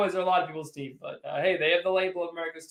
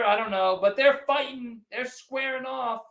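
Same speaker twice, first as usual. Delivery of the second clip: ordinary delivery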